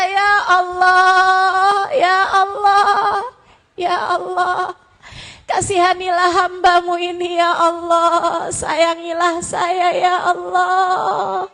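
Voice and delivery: loud, high, drawn-out pleading; tearful prayer. Acted. Servant begging Allah for mercy at night, tearful pleading. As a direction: wail to God as if through streaming tears. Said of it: "berdoa dengan linangan air mata".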